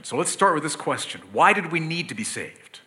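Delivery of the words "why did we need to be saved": ordinary talk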